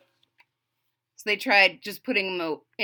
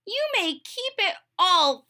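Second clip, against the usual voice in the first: higher, mildly sulky toad; Toad's voice; 10 semitones higher than usual. Acted. Toad giving up on Frog's willpower, higher voice. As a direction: hand it over grumpily.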